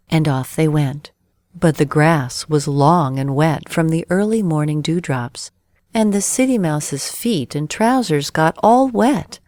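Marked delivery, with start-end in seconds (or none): none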